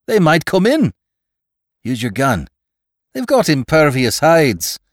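high, loud warning; urgent, alarmed yell. A narrator's reading. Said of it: "yelled Marnigan".

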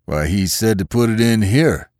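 puzzled farmhand's protest; lightly voiced baffled haymaker; wonderingly. A narrator's reading. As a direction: answer back in mild wonder.

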